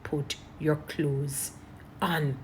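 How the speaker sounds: slow, measured, softly spoken plea; retold pleading. Officers pleading with a woman to get dressed, slow retold pleading. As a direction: plead gently, leaving space between the words. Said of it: "they were pleading with you".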